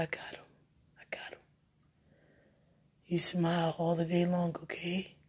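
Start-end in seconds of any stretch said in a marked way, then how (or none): 0.0-1.4 a soft whispered reassurance from above; God's hushed voice. Retold or imitated. Retelling God's reassurance to her, soft hushed voice. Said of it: "God say".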